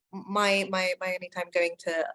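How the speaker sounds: ordinary talk, nothing marked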